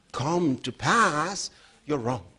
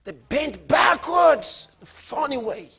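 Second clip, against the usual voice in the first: high, loud and slow cry; alarmed shout; 7 semitones higher, 4 dB louder, slower than usual. Retold or imitated. Father recalling daughter's legs bending, alarmed shout.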